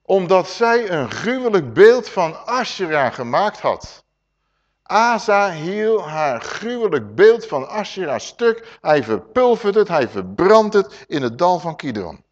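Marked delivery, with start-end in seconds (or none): 4.8-12.2 loud, slowed proclamation; forceful scripture reading. Acted. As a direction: read the smashing of the idol with force. Reads the destruction verse.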